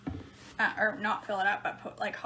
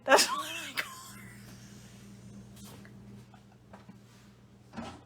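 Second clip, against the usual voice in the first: laughing shout; 5 dB quieter than usual. Real, not acted. To viewers about her minivan, laughing shout. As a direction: crack up while calling it out.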